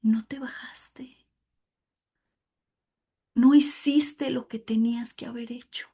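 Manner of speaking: hushed unvoiced whisper of the Spirit's rebuke; Holy Spirit rebuking her, hushed whisper. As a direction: whisper it like a voice in the conscience. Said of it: "diciéndome".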